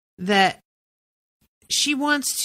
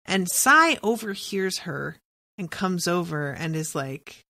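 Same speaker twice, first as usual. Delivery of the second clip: ordinary delivery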